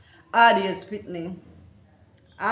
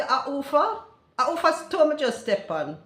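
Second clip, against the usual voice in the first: patois question, slightly high and louder; Jamaican patois; 6 semitones higher, 4 dB louder than usual. Shown as a demonstration. Asking whose toe was stepped on, in patois. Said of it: "A hoofa means whose is it".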